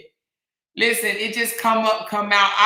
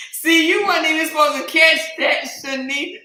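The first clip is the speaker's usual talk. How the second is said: louder teasing call-out; laughing call-out; 4 semitones higher, 5 dB louder than usual. Real, not acted. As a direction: call her out through laughter. Caught by listener, laughing.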